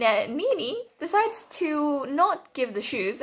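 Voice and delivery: ordinary talk, nothing marked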